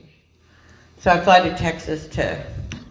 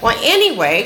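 ordinary talk

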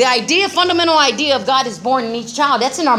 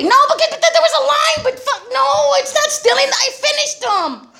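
much higher, pleading; flustered defense. Retold or imitated. Deborah defending herself to her sponsor, flustered and high. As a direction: protest in a high flustered rush. Defending eating unpaid jerky.

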